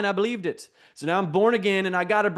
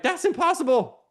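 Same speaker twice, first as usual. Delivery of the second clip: shocked disbelief; 10 semitones higher than usual. Retold or imitated. Preacher retelling his stunned reply to God, high and incredulous. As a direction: voice jumps far up in astonished disbelief. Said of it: "I'm like".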